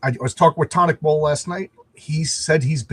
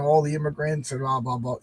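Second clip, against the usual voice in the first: ordinary delivery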